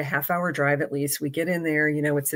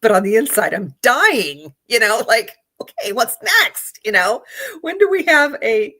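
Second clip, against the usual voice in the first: higher, louder, rushed nerves bursting out; inner panic; 6 semitones higher, 6 dB louder than usual. Real, not acted. Trying to act cool after the audition but dying inside, excited. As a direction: let the hidden excitement leak out. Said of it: "I'm trying to be cool".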